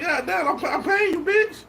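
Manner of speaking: high, indignant mock yell climbing up; exasperated mock outburst. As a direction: squeal it like you can't believe it.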